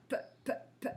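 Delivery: "p" said as voiceless puffs; puffed p. Shown as a demonstration. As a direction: pop out the "p" sound. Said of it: "on entend le P".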